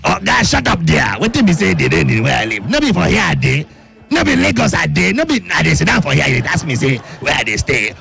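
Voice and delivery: indignant Nigerian Pidgin caller. Pretend. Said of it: "but [name], can you please tell me".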